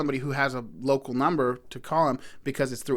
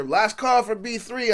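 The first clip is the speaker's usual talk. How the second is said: higher, slow imitation of a bus call; mock announcer's call; retold calling out; 7 semitones higher, slower than usual. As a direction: draw it out like a last-call announcement. Retold bus call heard in street.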